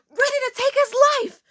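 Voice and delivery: high, loud hammed-up menace; dramatic emphasis. Storyteller lurking behind classmate, hammed-up fierce cry. Repeats threat with mock intensity.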